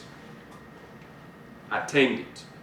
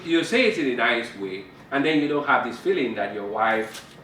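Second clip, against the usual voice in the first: ordinary talk, nothing marked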